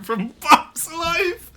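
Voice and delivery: slow words breaking into a high helpless laugh; can barely get the words out for laughing; laughing hard. Real, not acted. Reading the Bugs Life chat line to stream, cracking up.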